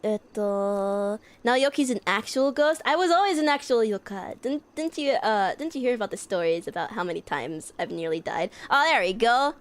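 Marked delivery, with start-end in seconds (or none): none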